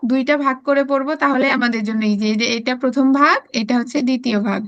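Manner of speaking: ordinary talk, nothing marked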